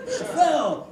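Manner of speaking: high, loud exclamation; mock alarm. Alarmed reaction to wife's cries.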